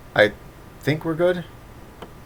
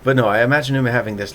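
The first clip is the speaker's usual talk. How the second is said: normal talking voice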